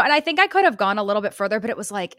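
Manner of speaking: ordinary delivery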